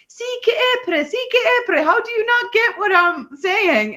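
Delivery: high, mangled Finnish; mock Finnish. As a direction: imitate a Swede butchering Finnish. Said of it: "he was just like".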